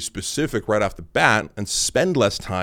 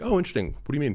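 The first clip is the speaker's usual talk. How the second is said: higher-pitched, politely interested reply; polite curiosity; 5 semitones higher, much faster than usual. Retold or imitated. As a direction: ask it politely, voice lifted. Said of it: "I asked in a polite way".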